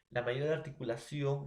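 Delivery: normal talking voice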